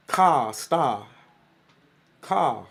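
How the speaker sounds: lightly imitated Boston accent, dropped R; mock Boston, word by word; playful Boston accent. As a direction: toss out Boston-accent words for fun. Mimicking Boston speech after shout-out.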